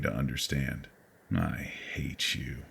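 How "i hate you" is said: soft, unvoiced inner hatred; soft slow unvoiced thought. A narrator's reading. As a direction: think it under your breath. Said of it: "I thought".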